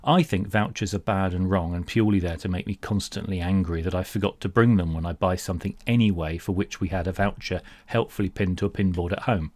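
ordinary talk, nothing marked

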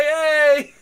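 high, loud leprechaun yell; leprechaun shout. Retold or imitated. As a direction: bark it out like a leprechaun. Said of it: "the leprechaun open that tree, say".

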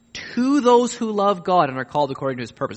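ordinary talk

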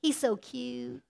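soft, slow, lovestruck gushing; a dreamy crush, mildly acted; hushed and slow, lightly swooning over a crush. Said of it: "Remember when you first fall in love?".

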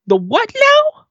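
mock bewildered question; baffled. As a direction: play up the confusion. Retelling confused reaction to Dan.

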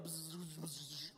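soft imitated buzzing of bugs. Imitating bugs buzzing around his face on the river, soft buzz.

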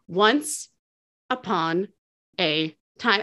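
one word at a time, pausing between; slow demo, a pause after "Once" and after "upon"; staccato. Shown as a demonstration. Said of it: "So it would go".

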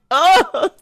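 high, mock-despairing groan; exasperated groan. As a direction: groan as if it's that character again. Retold reaction to same character again.

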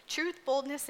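ordinary talk, nothing marked

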